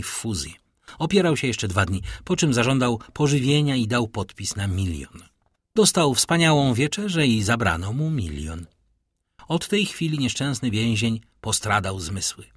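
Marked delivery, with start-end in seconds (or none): none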